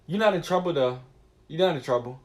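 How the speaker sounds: usual speaking voice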